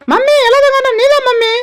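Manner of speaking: ordinary delivery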